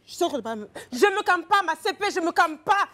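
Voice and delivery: [name] playing Suzy against Prince; loud Suzy reenactment. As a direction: refuse to calm down, loudly. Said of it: "mettre dans la peau de Suzy".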